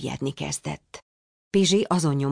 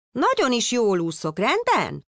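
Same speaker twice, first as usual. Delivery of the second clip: put-on higher, indignant child snapping back; angry protest; 7 semitones higher than usual. A narrator's reading. Annoyed son protests to his worried father, higher. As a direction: push back at the fussing dad. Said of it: "tiltakozott dühösen Némó".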